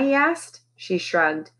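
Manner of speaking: ordinary delivery, nothing marked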